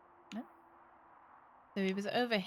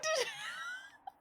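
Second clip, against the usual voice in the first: high squealing laugh; 4 dB quieter than usual. Real, not acted. Laughs at own sound effect.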